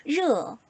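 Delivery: 르 with the tongue tip curled back; tongue-curled R sound. Shown as a demonstration. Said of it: "혀를 살짝 만 상태에서".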